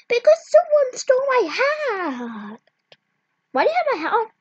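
loud high upset yell; upset shouting. Acted. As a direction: cry out the complaint loudly. Upset child accusing.

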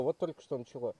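ordinary talk, nothing marked

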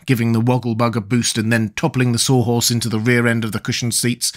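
usual speaking voice

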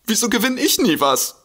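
high, amazed disbelief; high envious astonishment; astonished. Acted. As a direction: squeal it out in envious surprise. Astonished at lottery win.

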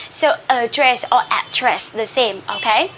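usual speaking voice

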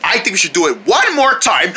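normal talking voice

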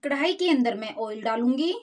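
ordinary delivery

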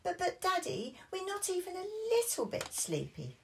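high whiny protest; high childish protest; protesting Peppa. Acted. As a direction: insist you are not sleepy. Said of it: "replied Peppa".

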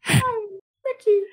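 put-on high, strained anime girl voice. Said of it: "That was my girl voice".

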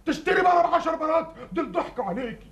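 high-pitched, appalled disbelief; incredulous scolding. Acted. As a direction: exclaim high, as if at sheer madness. Incredulous at her foolish purchase. (استنكار مذهول بصوت عالي الطبقة)

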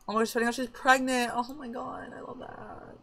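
high, breathy gush fading to a whisper. Real, not acted. Gamer cooing over a pregnant character, excited. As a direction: coo over the happy news.

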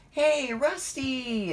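very high, drawn-out excited hail; exclaiming. A narrator's reading. Thomas spots his friend Rusty, exclaiming happily. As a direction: call out high and slow, stretching the name. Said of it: "exclaimed Thomas".

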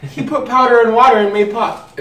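laughing. Real, not acted. Laughing about the fizz powder.

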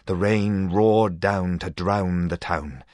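usual speaking voice

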